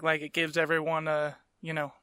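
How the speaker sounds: ordinary talk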